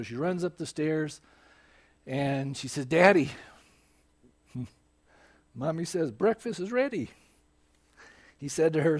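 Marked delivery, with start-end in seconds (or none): 2.9-7.1 put-on little girl calling. Daughter calls Daddy to breakfast, little girl calling. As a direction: imitate a small child calling Daddy.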